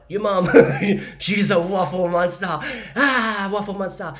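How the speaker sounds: loud, high, hammed-up alarm; playful over-the-top finger-pointing; mock accusation. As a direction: cry out the blame in pretend horror. Mock blaming mum to the kids.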